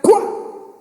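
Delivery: voiced gunshot burst; gunshot noise. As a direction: spit out a pistol shot. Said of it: "it's like".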